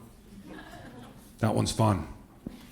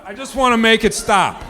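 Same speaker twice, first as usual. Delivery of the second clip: ordinary talk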